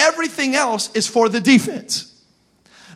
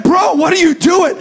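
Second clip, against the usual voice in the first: mock yell; 7 semitones higher, 5 dB louder than usual. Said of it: "I'm like".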